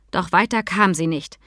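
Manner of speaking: usual speaking voice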